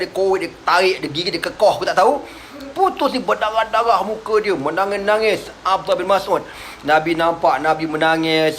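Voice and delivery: ordinary delivery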